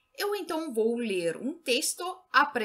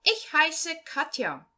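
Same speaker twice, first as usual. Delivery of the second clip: slow, clear German read-aloud, each phrase set apart; slow learner reading; slower than usual. Shown as a demonstration. Teacher reading a German text to Portuguese-speaking beginners, slow and clear. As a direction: read it out slowly so beginners can follow.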